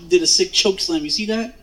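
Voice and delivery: ordinary talk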